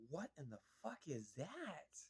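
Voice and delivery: soft, slow, put-on voice of horror; stunned disbelief. Viewer stunned by the old gremlin on screen, soft and slow. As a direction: recoil at something hideous.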